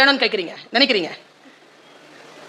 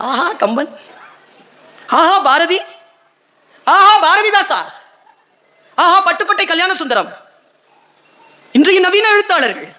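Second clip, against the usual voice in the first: rapturous praise; 5 semitones higher than usual. Real, not acted.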